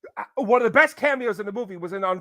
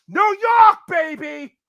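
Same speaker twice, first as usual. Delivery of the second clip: put-on New York accent; 9 semitones higher, 9 dB louder, slower than usual. Voicing Mario as New Yorker.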